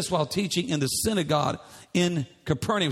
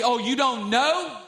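annoyed wife; 3 semitones higher than usual. Retold or imitated. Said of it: "it's like".

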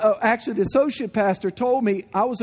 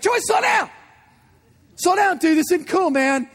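panicked yell; 8 semitones higher than usual. Retold or imitated. Panicked yelling at friend skiing.

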